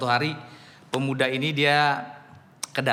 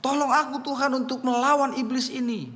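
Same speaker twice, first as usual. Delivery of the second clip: retold cry for help; 12 semitones higher than usual. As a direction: stretch it out high like a desperate plea. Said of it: "dia berteriak lagi".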